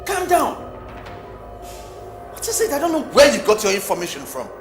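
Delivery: high and loud, building to a yell; rising angry shout. Acted. Husband defending wife, escalating.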